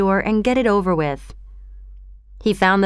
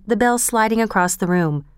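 ordinary delivery, nothing marked

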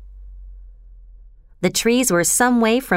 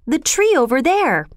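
higher-pitched, startled pointing-out; sudden cry; 6 semitones higher than usual. A narrator's reading. Boy showing his sisters the strange tree, sudden cry. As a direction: call attention to it with raised pitch. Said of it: "cried Peter suddenly".

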